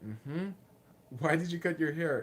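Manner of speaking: usual speaking voice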